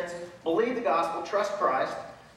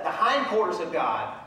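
normal talking voice

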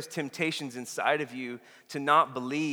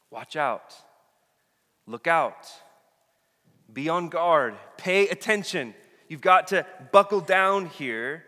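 spaced-out warnings, building louder and higher; emphatic caution; 3 semitones higher, 8 dB louder, slower than usual. Retold or imitated. Jesus cautioning his disciples about leaven, urgent warning. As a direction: warn them, each command more insistent. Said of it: "And he cautioned them".

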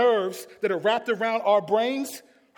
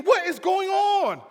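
high, loud, drawn-out disbelief; stretched, raised exclamation of confusion; bewildered outcry; 8 semitones higher, 4 dB louder than usual. Acted. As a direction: cry it out as the baffled follower. Follower's bewildered outcry, acted.

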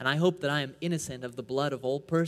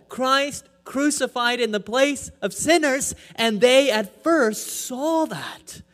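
loud rising pulpit preaching, ringing out; preaching at full force; 5 semitones higher, 7 dB louder than usual. Acted. Preacher driving the point home to the congregation, loud and ringing. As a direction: proclaim it to the whole congregation.

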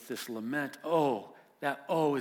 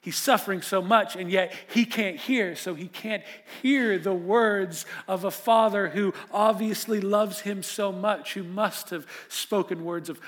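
raised, louder sermon voice building pathos; impassioned preaching; 7 semitones higher, 10 dB louder than usual. Acted. Pastor to congregation about the suffering boy, impassioned preaching. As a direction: preach it out with full force. Preacher's rising emphatic passage.

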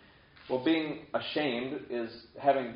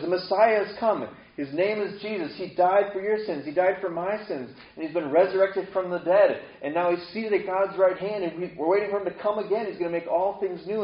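high, louder, eager announcement of good news; excited proclaiming; 6 semitones higher, 6 dB louder than usual. Pretend. New believer telling others the Messiah has come, excited. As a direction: burst out with the joyful news.

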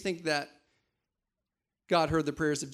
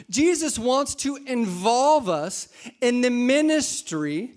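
rising, louder fervent preaching; preaching at full force; 7 semitones higher, 6 dB louder than usual. Real, not acted. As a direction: build the sermon to a climax. Preaching climax.

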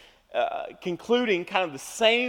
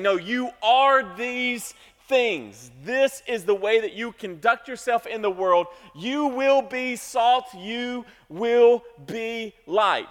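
slow, louder, stress on "will" in "You will be salt, you will be light"; slow emphatic declaration; 3 semitones higher, 5 dB louder, slower than usual. Shown as a demonstration. Pastor declaring Jesus' words to believers, slow and forceful. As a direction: proclaim it slowly, pressing "will". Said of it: "but he's saying".

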